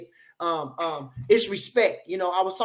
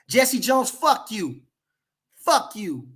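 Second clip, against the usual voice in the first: ordinary delivery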